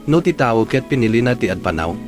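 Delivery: ordinary talk, nothing marked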